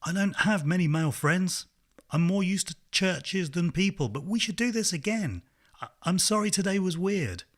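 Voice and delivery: shouting into a friend's ear above the crowd; shouting over a crowd. A narrator's reading. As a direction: call it out over a roaring crowd. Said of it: "I shouted into Ross's ear".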